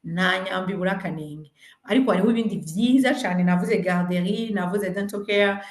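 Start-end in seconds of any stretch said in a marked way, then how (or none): none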